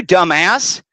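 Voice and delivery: usual speaking voice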